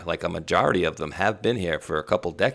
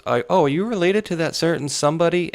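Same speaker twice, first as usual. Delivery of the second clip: put-on high, louder girl asking a suspicious question; girl in the back; 11 semitones higher, 4 dB louder than usual. Poll worker girl asks if he is related, higher and louder. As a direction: squeak it out like a curious young poll worker. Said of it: "one girl in the back goes".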